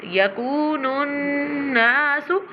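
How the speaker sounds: slow "Yakunun nasu", n hummed long through the nose; nasal gunna. Shown as a demonstration. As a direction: linger on the nasal n before "nasu". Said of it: "because gunna is here".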